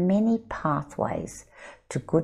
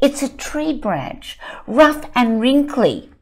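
higher, louder insistent claim; arguing child; 7 semitones higher, 8 dB louder than usual. Acted. Child insisting to the others, loud and higher. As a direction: insist you're right.

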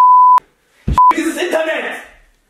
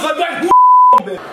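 shrieked much higher and louder in real rage; furious scream; 8 dB louder than usual. Real, not acted. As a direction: explode at the player who cost you money. Wutschrei nach Wettverlust. (rasender Wutschrei, sehr hoch und laut)

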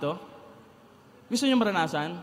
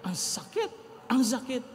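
strained pain; 3 semitones higher than usual. Retold or imitated. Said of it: "pag huminga ako ng todo".

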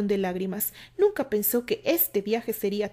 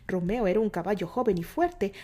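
ordinary delivery, nothing marked

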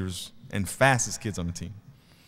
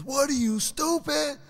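put-on very high little-kid voice; Bagby; 14 semitones higher than usual. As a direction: mimic a small boy, way up high. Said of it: "little Bagby is sitting next to me".

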